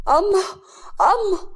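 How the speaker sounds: high, loud cry for mother; frightened cry. A narrator's reading. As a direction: call out for mother in terror. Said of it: "هنا بصت له بخوف". (صرخة خوف)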